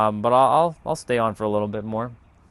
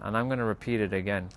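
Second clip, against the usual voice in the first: ordinary talk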